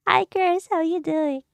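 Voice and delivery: ordinary talk, nothing marked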